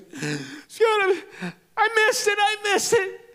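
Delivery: high, loud wailing plea; imitating a sobbing girl. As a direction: cry it out, shaking someone by the shirt. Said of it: "and she goes".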